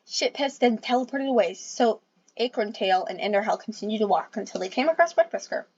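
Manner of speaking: ordinary delivery, nothing marked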